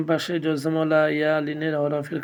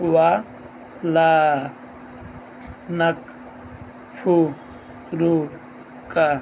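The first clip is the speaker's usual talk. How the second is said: measured syllable-by-syllable Quran drill; broken-up syllables; slower than usual. Shown as a demonstration. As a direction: recite one syllable, stop, breathe, then the next. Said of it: "থামবেন, অর্থাৎ নিঃশ্বাস ফেলবেন প্রত্যেকটি হরফে".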